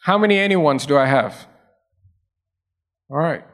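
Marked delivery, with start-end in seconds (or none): none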